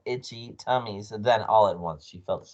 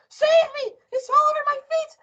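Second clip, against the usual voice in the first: very high, loud shrieking; panicked scream; 5 dB louder, faster than usual. A narrator's reading. Wife with creature on her feet, screaming. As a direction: shriek for rescue in full panic. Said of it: "screamed Missus Twit".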